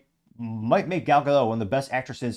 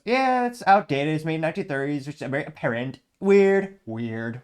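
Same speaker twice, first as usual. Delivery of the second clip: mocking Rachel Zegler; 4 semitones higher, 3 dB louder than usual. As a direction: ham up a prissy starlet dismissing an old film. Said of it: "I'll attempt her rudimentary, uh, accent or impression. She goes".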